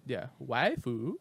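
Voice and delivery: slow waifu in a Japanese accent. Shown as a demonstration. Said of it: "Say it in a Japanese accent".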